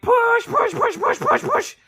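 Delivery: very high, strained squeal; acting out labor in a squeaky, urgent chant; frantic mock pushing. Acts out pushing during a contraction.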